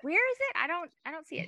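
mock searching parent. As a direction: mimic the parents hunting a tiny spider. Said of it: "They're like".

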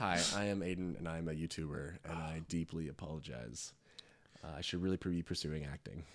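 soft, low YouTuber apology; mock-sincere contrite confession; apology video voice. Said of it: "We're doing our apology video".

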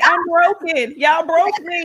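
joking wail of defeat; mock despair. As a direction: play the broken victim for laughs. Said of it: "It's like".

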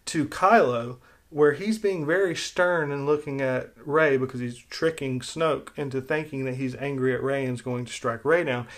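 ordinary talk, nothing marked

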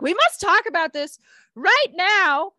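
high, loud and urgent; urgent excited voice. Retold or imitated. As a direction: blurt it out all at once. Acting out her urgent inner voice.

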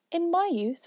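hammed-up old father; old man voice. A narrator's reading.

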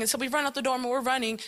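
usual speaking voice

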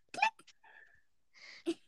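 high whispered "Klick." like a camera shutter; camera click sound. Retold or imitated. Friend retelling a gif mimics a camera click. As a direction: make the click of a photo being taken.

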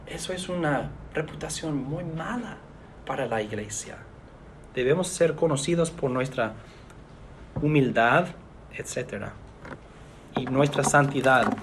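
ordinary delivery

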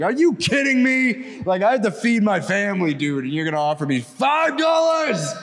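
high-pitched outraged shouting; retold screaming. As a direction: yell in disbelief, re-enacting the blowup. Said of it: "screamed at him for".

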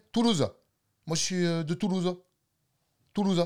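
Toulousain, voix plus haute, finale de «Toulouse» coupée court; accent toulousain. Shown as a demonstration. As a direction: imite Toulouse sans laisser traîner la finale. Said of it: "Les Marseillais et les Toulousains". (accent toulousain écourté)

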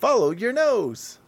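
high, lilting mascot catchphrase; Toucan Sam. Acted. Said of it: "And Toucan Sam being of".

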